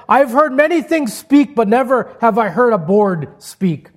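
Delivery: high, loud, astonished exclamation; amazed chief. Retold or imitated. Native chief amazed that a board could speak, exclaiming. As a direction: marvel out loud at a talking board.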